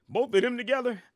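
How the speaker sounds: high pitched amazed follow-up; incredulous. Real, not acted. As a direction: squeak it out in disbelief. Disbelief at pairing.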